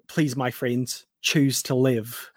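put-on soft, slow Australian accent; understated, well-coached Aussie accent, slowing at the end. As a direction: quote it gently in an Aussie accent. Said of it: "you said that way better than I did".

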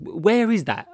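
high, loud puzzled question; incredulous, voice jumping up; baffled. Retold or imitated. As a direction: react in disbelief to an unknown place. Retold reaction to unknown place.

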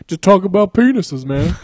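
hammed-up comedian voice; Tracy Morgan. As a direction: blurt it out loudly as a goofy comedian. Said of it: "He does a mean Tracy Morgan impression".

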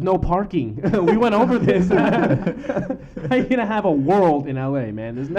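loud, high, laughing through the words; cracking up while ranting. Real, not acted. Laughing, loud rant about LA parking.

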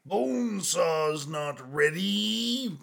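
slow hammed-up wrestling growl; wrestler promo voice. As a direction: drag it out like a wrestler. Joking about wrestler Bonesaw.

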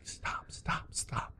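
hushed, whispered plea; urgent whisper. Retold or imitated. Heckler's wife trying to stop him from the front, whispering. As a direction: whisper it very softly, imitating an embarrassed wife.

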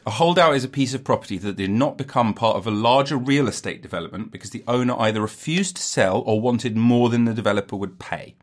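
normal talking voice

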